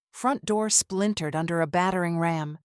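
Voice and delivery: ordinary delivery, nothing marked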